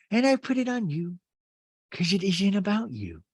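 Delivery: a hint of a tearful Rinna; Rinna imitation. Recapping Housewives, imitating Rinna's apology to Sutton, lightly voiced. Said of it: "my Rinna imitation".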